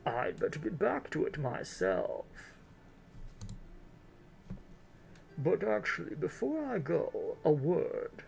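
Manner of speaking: drawn-out elderly lady, put on thick; grandma voice. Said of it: "my grandma voice".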